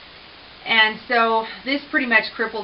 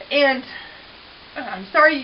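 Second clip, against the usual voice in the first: voice breaking; 4 semitones higher, 6 dB louder than usual. Real, not acted. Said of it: "it gets emotional".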